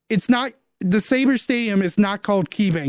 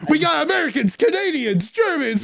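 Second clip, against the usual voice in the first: pitched-up hype announcer; 7 semitones higher than usual. Retold or imitated. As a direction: bark it like a sale ad. Echoing the fire sale ad.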